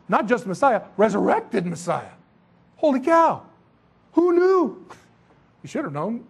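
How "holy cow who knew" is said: mock astonishment.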